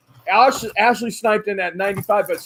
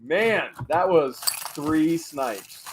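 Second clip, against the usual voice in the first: slow; 3 semitones lower, 6 dB quieter, much slower than usual. Real, not acted. Exhausted after auction.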